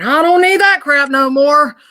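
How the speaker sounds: loud, high, drawn-out declaration; busting through defiantly. Retold or imitated. Medium channels a spirit done with it, defiantly. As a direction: throw it out big, slow and high. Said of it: "it's his way of saying".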